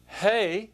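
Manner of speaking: aspirated "Hey"; rough breathing shown. Shown as a demonstration. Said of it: "see the rough breathing mark".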